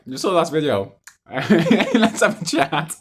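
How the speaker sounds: high, loud, laughing through it; laughing quote. Retold or imitated. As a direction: quote him while cracking up. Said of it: "he messaged me like".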